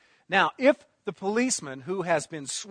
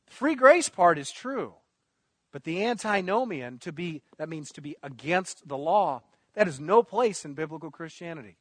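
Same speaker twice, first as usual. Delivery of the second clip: ordinary talk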